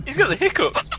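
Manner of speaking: laughing. Real, not acted. Friend hiccuping during pepper challenge.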